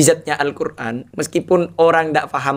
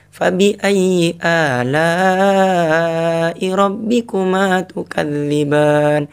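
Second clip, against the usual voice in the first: gentle recitation; 3 semitones higher, much slower than usual. Shown as a demonstration. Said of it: "Intonasinya beda kan?". (tilawah lembut bernada)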